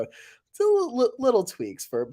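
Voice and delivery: ordinary delivery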